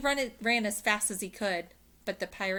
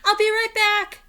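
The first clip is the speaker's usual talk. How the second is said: high, loud called reply; calling out; 14 dB louder than usual. A narrator's reading. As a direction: call it back over distance. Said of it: "Jack called".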